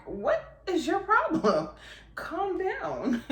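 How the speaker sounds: high, drawn-out mock scolding; play-scold the screaming toddler with a laugh; exasperated mom. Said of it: "And I'm like".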